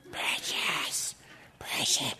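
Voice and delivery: hissed whisper clutching the precious; mock Gollum whisper over champagne. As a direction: breathe it like a greedy creature.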